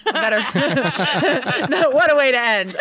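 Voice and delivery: real laughter, amused at herself; laughing. Real, not acted. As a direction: giggle at your own gloomy ending. Laughing at her bleak ending.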